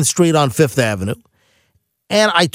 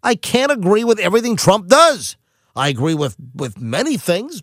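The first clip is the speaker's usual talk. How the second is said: ordinary talk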